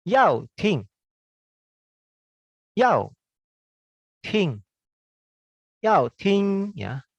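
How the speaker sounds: Yao ting both said with sharp high falling tones; fourth tones. Shown as a demonstration. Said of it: "sekarang nada empat".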